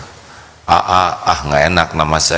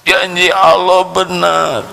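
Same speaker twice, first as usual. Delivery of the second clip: tearful affirmation; 11 semitones higher than usual. Acted. Crying family affirms.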